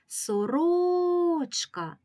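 "сорочка" called out with a long, high second syllable RO; drawn-out call peaking on the RO of "сорочка"; calling the word. Shown as a demonstration. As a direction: stretch and raise RO in "сорочка", as if calling it. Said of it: "Погукаємо це слово".

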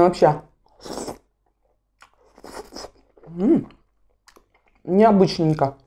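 chewing while talking; speaking between bites; mouth full of noodles. Real, not acted. Seen 1: Ate noodles at -3; still chewing, next bite at lips.

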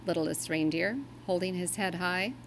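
ordinary delivery

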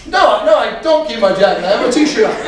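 high-pitched exasperated insisting; comically worked-up protest. Retold or imitated. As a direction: act out losing patience at being misunderstood. Repeated, exasperated answer to misunderstanding.

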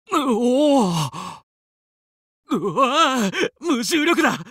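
long, slow wavering cry; drawn-out yell. Acted. Pilot tumbling in zero gravity, slow wavering cry. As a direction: cry out while floating loose.

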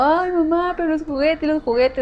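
put-on high pleading little-girl whine; childish whine. Little girl begging her mom for toys, high whining. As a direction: whine like a child throwing a tantrum. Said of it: "le hice medio berrinche".

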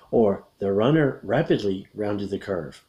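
ordinary talk

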